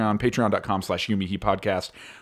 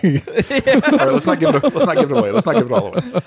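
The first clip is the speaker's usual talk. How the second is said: much higher and louder, laughing through it; laughing hard while stopping a spoiler, high and loud; 12 semitones higher, 7 dB louder than usual. Real, not acted. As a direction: cut them off while cracking up.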